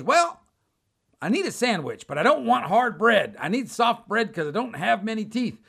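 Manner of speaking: high, insistent voice of a simple man; simple stranded traveler. Retold or imitated. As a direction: imitate a needy traveler making demands.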